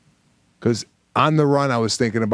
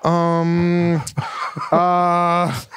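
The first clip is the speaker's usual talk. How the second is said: drawn-out, higher hesitant um; flustered script reader buying time; script reader stalling; 4 semitones higher than usual. Retold or imitated. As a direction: stall with long hums while hunting for the line. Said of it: "I go line and I hear".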